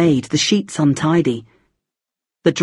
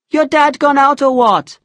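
Puri's voice; 9 semitones higher, 3 dB louder than usual. A narrator's reading. Puri voiced.

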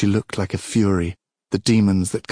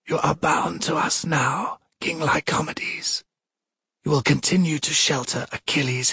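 cold goddess; 7 semitones higher than usual. Acted. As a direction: speak down to a mortal king. Goddess voice of Thetis.

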